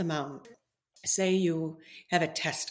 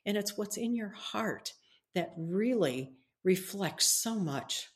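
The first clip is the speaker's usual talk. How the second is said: ordinary delivery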